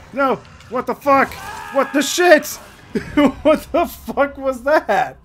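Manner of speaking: high, loud startled yelling; loud cry, dragged out at the end; shocked outburst. Real, not acted. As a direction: shout in alarmed disbelief. Shocked by game scare.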